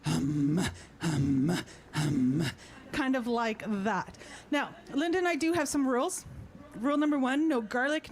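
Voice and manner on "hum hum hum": repeated deep throat-sung "hum"; monster voice. Shown as a demonstration. Teacher shows how the two parts combine, monster voice. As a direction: pulse "hum" low, like a monster. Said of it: "So you end up singing".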